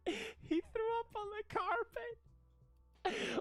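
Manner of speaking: high, very slow fake sobbing; mock crying. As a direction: wail it like a bawling kid. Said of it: "he cried so hard".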